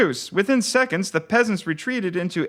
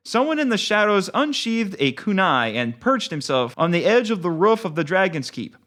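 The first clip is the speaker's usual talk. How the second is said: usual speaking voice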